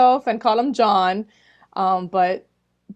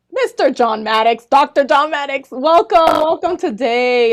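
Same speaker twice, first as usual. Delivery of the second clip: louder, higher host welcome; big welcome; 5 semitones higher, 9 dB louder than usual. Real, not acted. Host welcoming her guest to the show, loud and excited. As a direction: announce him like a star guest.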